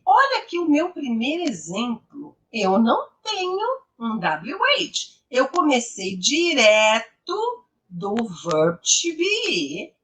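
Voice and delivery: ordinary delivery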